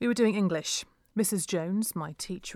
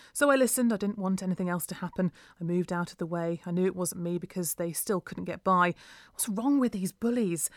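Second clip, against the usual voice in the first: plain reading voice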